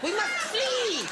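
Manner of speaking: high, slow, hammed-up vampire cry. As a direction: flee the dawn like a melodramatic vampire. Mock vampire fleeing sunlight.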